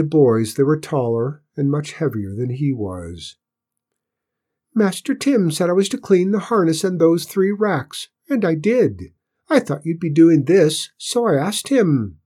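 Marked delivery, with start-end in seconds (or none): 4.7-12.2 high, earnest little voice; small squeaky Cinders. A narrator's reading. Cinders justifies himself to bigger boys, squeaky. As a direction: explain it high and firmly. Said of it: "Cinder's small, squeaky voice".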